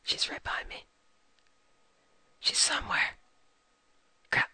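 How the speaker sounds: hushed whisper so she won't hear; tense whisper, pursuer close behind. Real, not acted. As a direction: breathe it out, scared of being found. Hiding from a pursuer on radar.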